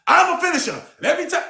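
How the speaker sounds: energetic demo. Shown as a demonstration. Coach showing the client how to say it, high and energetic. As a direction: show her how to say it with fire. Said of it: "say it like this".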